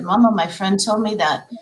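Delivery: usual speaking voice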